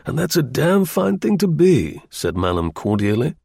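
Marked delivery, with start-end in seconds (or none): 0.0-2.0 cordial colonel, pitched up; hearty officer approving; military officer. A narrator's reading. Colonel voiced by narrator.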